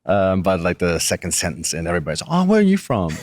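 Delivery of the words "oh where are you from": high, eager mock question; mock curiosity. Americans ask him about his accent, playfully over-curious. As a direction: imitate a delighted, nosy stranger.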